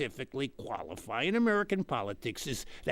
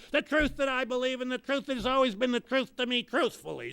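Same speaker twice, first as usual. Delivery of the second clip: whiny voter; 6 semitones higher than usual. Retold or imitated. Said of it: "and they say".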